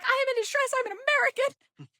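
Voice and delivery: loud, very high hammed-up cry; dramatic distress. Traveler phoning front desk, melodramatic high wail. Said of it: "this is just so dramatic".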